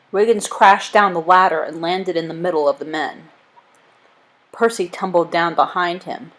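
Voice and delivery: ordinary delivery, nothing marked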